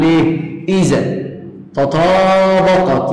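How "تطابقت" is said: تطابقت drawn out very slowly, each syllable stretched; stressed word. Shown as a demonstration. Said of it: "يعني إيه؟". (تطابقت ببطء شديد ممدودة)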